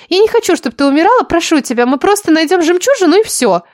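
high, urgent character voice; pleading schemer Lilya. Acted.

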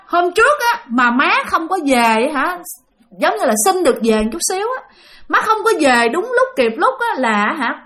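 high, exasperated telling-off; reproachful scolding. Retold or imitated. Dead grandmother rebuking the mother for poor childcare, high reproachful scolding.

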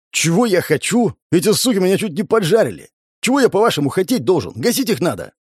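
high, heated tirade; outraged. A narrator's reading. Man demanding action after nearly being burned, outraged. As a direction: throw the question back in indignation. Outraged answer to boss's question.

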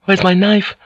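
sudden high alarmed question. Acted. Mariko searching her sash, high and alarmed. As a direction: panic as the hand finds nothing.

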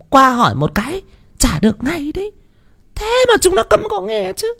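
put-on high, shrill-pitched scolding old woman; grumbling old wife. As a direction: nag about the sons in a high old-woman voice. Bà vợ chửi các con. (giọng bà già cao, càu nhàu chửi con)

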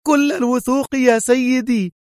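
high, proud exclamation; lieutenant boasting to her chief; proud happy cry. A narrator's reading. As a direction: call out full of pride and joy. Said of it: "صاحت في فخر وسعادة".

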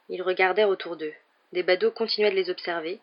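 ordinary delivery, nothing marked

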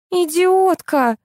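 high, drawn-out indignant cry; indignant outburst. Acted. Asya jumps at her friend's accusation, indignant outburst. Insult in an acted quarrel.

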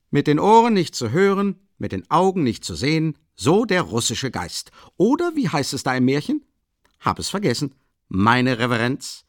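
laut und höher, jovial; gutgelaunter Ruf. A narrator's reading. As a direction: schallend und launig begrüßen. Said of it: "rief plötzlich eine bekannte Stimme". (der Leutnant ruft Raskolnikow jovial zu)

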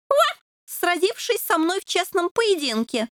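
bow with words like a tiny knight; solemn chivalry. A narrator's reading. Puppy formally challenges the devil to a duel, solemn. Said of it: "торжественно сказал".

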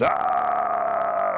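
deep loud bellow; low roar of pain. Acted. Bear shot in the bottom, low pained bellow. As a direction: bellow it out, hurt and startled. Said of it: "And Bear screams".

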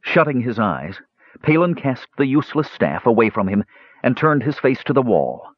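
ordinary delivery, nothing marked

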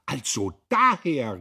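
slow, loud, high cry of realisation; drawn-out triumphant exclamation; exclaiming. A narrator's reading. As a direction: cry out as it dawns on you. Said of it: "rief Magda".

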